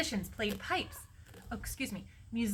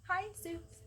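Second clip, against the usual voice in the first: tiny, high, soft greeting; intimidated, little and quiet; small timid voice; 10 semitones higher, 6 dB quieter than usual. A narrator's reading. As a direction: shrink the voice to a meek squeak. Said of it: "His voice was small, too".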